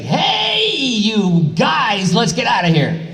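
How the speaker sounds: "Hey" cried out very high, then the rest pulled long; mock stretched dub read; stretched Goku line. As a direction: stretch the line out to fill the mouth flaps. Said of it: "you had to get creative".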